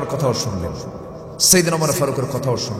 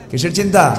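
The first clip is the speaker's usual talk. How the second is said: raised, pressing preacher's question; louder rhetorical question; 5 semitones higher, 6 dB louder than usual. Acted. Preacher quizzing the audience, raised pressing voice. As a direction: push the question at the crowd.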